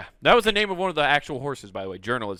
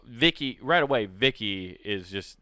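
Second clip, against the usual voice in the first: ordinary talk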